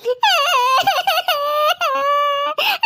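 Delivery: put-on high, wailing child's cry. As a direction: wail like a spoilt little girl. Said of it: "എന്തിനാ ഇവളിങ്ങനെ മോങ്ങണത്?". (കുട്ടിയുടെ ഉറക്കെയുള്ള കരച്ചിൽ)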